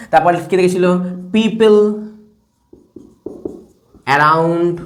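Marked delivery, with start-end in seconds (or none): 1.3-4.8 stretched words with a long pause between; drawn-out dictation. Shown as a demonstration. Teacher writing "people around", drawn-out dictation. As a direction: say each word as you write it.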